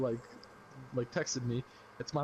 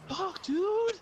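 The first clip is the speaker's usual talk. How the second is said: very high squealed exclamation; high-pitched outburst; 4 dB louder than usual. Real, not acted. Storyteller breaks off telling about Susie and Tom, high squeal. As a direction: squeal it out far above your voice.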